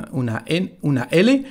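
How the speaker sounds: normal talking voice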